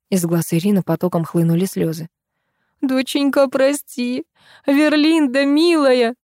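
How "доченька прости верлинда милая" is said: tearful high plea; pleading mother. A narrator's reading. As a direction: beg forgiveness, voice lifted. Mother begs daughter's forgiveness.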